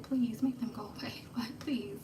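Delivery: breathless whisper, barely voiced; whispered prayer. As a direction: whisper it so nobody hears you. Hiding, praying for rescue.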